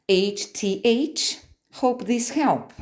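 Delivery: usual speaking voice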